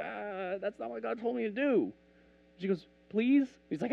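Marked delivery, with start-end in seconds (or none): 0.0-1.9 high, whiny mock protest; reluctant refusal. Jesus to Gentile woman asking healing, reluctant. 3.1-3.5 high, pleading question. Acted. Gentile woman begging Jesus for healing, pleading.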